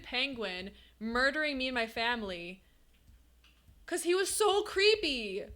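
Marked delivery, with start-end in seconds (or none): 3.9-5.4 high, drawn-out complaint about a creep. Real, not acted. Streamer tells chat about a nightmare penguin, creeped out.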